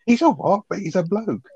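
high, squeaky mock disbelief; shocked high squeal. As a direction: squeak it out like a flabbergasted Del.

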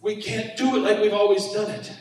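ordinary talk

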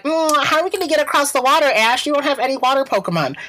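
high, fast, whiny mock Misty voice. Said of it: "the fucking Misty sitting there like".

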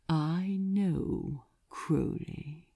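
a hint of velvet menace, soft; soft and alluring. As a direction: lure him in gently. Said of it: "so soft and alluring".

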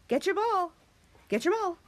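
high, short commands; coaxing a dog. Real, not acted. Owner sends doggy after ball, coaxing. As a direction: urge the pet on in a high voice.

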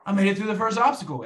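he cheers that he cleared the first obstacle; excited exclamation. Retold or imitated. He tells himself he made it, excitedly.

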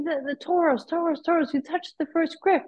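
high-pitched, stumbling repetition; retold insistence. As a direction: mimic a marshal trying to get through. Retold marshal struggling to explain.

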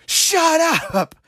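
put-on high, piercing kid's yell; little girls yelling. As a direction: mimic two small girls shouting across the street. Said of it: "they're yelling".

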